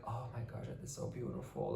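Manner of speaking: hushed, breathless awe, a little fast; whispering in awe at the view; awed whisper. Retold or imitated. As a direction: whisper it as if in a church. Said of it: "I was like".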